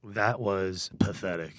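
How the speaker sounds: low, slow, soft scorn; mock disdain. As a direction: drag it out like a let-down judge. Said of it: "mock disdain verdict".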